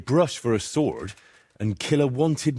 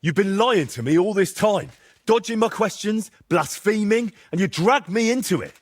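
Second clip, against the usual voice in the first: higher, indignant reproach; angry accusation; 8 semitones higher than usual. Acted. Henry angrily accuses his friend of lying. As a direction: list his sins higher and heated.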